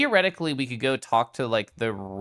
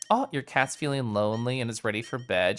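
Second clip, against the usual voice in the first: ordinary talk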